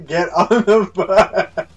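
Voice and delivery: loud, slow plea broken up by laughter; laughing shout. Real, not acted. Player to stalling friend, get on the bike, laughing. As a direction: laugh helplessly while yelling it.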